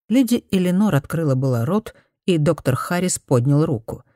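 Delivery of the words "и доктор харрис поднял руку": plain reading voice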